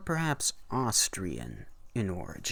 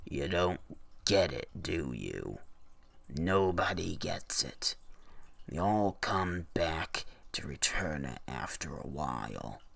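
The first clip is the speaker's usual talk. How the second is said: put-on low, weary old shopkeeper voice; 4 semitones lower than usual. Shopkeeper warns the honeymooner, low and weary. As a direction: drop low and tired, a worn-out storekeeper.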